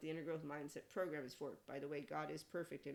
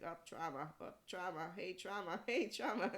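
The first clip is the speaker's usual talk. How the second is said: mock alert; 2 semitones higher, 3 dB louder than usual. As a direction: call out each sighting more gleefully, breaking into a laugh. Mock spotting trauma while watching TV.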